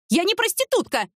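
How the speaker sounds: high-pitched indignant squeal; shrieked protest. A narrator's reading. Insulted ex-wife protesting to her former husband, shrieking. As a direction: squeal it out in outrage. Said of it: "взвизгнула Люба".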